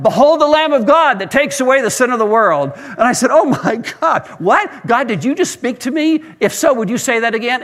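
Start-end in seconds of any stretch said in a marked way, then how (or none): 0.0-2.7 high, loud heavenly proclamation; booming call heard from the street; voice crying out. Retold or imitated. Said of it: "this voice that cried out". 3.3-7.6 astonished. Retold or imitated. Woman stunned by a voice.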